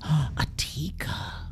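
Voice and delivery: breathless slow whisper of awe; gasped whisper. A narrator's reading. Townsfolk whispering in shock at the stick monster.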